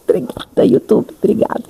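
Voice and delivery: breathless whispered thank-you through a laugh; hushed, amused thanks. Pretend. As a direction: whisper it as a mock-grateful aside.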